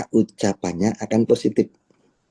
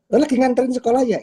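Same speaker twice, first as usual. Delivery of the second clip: fast, much higher friendly question; chatty leader; 14 semitones higher, much faster than usual. Acted. Leader asking the member on the phone, fast high chatty question. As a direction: rattle it off cheerfully.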